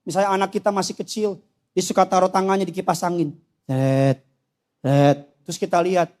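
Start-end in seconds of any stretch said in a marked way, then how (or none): 3.6-5.2 low drawn-out rattle "tet"; imitated fan noise. Father mimics child's hand in fan, low noise. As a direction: imitate fan blades catching fingers.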